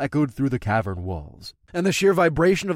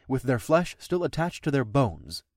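ordinary delivery, nothing marked

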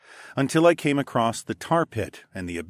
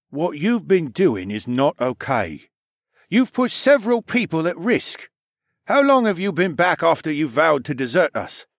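stern, louder captain voice; 7 semitones higher, 5 dB louder than usual. A narrator's reading. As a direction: scold like a stern uncle. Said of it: "he said in his deep voice".

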